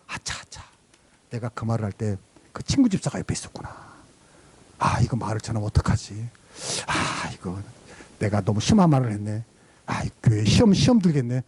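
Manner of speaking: anxious low self-talk, regretful; worried muttering. Retold or imitated. Pastor worrying to himself. (걱정하며 혼잣말)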